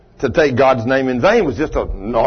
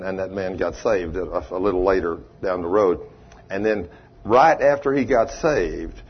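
usual speaking voice

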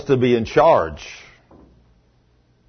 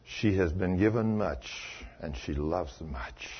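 tender, reverent words; 9 semitones lower, 13 dB quieter than usual. Retold or imitated. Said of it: "He says".

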